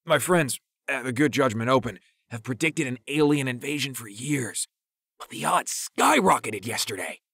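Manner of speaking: high, excited voice strained around a held hit; straining, holding breath. A narrator's reading. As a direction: struggle to speak while holding in smoke. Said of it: "struggling to speak while holding in".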